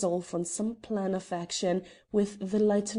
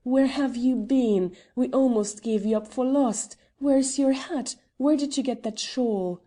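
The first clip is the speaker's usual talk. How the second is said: joyful cry; 5 semitones higher, 5 dB louder than usual. A narrator's reading. The Earl hugging Edith on her return, crying out in relief. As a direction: pour out relief at finding her. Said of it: "he cried".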